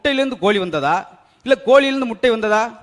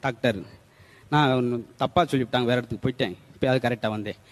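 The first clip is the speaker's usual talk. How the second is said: low, subdued murmur to a doctor; meek patient; 9 semitones lower, 5 dB quieter than usual. Acted. Patient apologising to the doctor for the wrong room, meek. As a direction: explain yourself humbly, low and soft.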